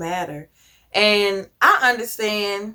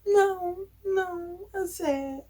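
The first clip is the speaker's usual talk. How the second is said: put-on high, soft small-child voice, fading; a tearful kid admitting she's sad; sad little girl; 11 semitones higher, 7 dB quieter, much slower than usual. Daughter telling mom she is sad, high and soft.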